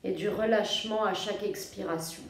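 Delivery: soft, low-key yoga nidra voice with long pauses; guided meditation. Real, not acted. Yoga teacher guiding listeners to feel their breathing, calm meditation voice. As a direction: guide them gently into rest, phrase by phrase.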